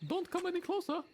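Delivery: very high cockney warning; cockney robber warning. Acted. A robber warning hostages in a high cockney voice. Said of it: "That's good, man".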